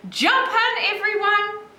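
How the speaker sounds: high-pitched call to the friends; excited shout. A narrator's reading. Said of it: "he shouted".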